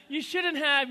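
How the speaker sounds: high-pitched mock gracious thanks; polite royal parents. Royal couple thanking a guest for a gift, mock gracious. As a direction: imitate gushing royals, pitched well up. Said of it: "they're like".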